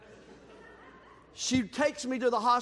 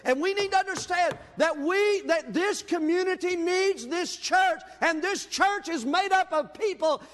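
preaching; 4 semitones higher than usual. Acted. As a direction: preach it to the congregation. Preaching builds up.

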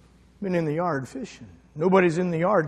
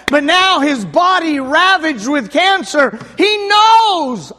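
high, loud, shouting preacher; pulpit-pounding revival preaching; preaching at full force; 10 semitones higher, 9 dB louder than usual. Acted. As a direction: build each line like a hammer blow. Preacher building to a climax.

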